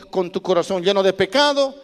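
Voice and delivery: usual speaking voice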